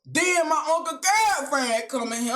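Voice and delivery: ordinary talk, nothing marked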